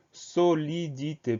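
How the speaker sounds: "solidité" split slowly into so-li-di-té; slow dictation of "solidité", each syllable separate. Shown as a demonstration. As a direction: sound out "solidité" one syllable at a time. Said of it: "Première syllabe".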